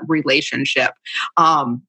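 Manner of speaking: ordinary talk, nothing marked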